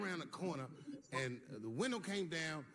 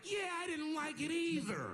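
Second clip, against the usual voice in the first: much higher, slow timid agreement; 12 semitones higher, 3 dB louder, slower than usual. Retold or imitated. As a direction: pipe up nervously in agreement.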